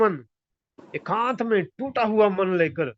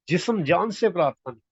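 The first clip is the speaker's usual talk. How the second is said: usual speaking voice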